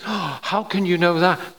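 gasping, high mock disbelief; mock shock. Preacher playing an objector to a booked ticket, mock shock. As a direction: draw it out high, feigning scandal.

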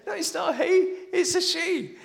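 high, amused correction; mother correcting. Retold or imitated. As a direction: correct him with a laugh.

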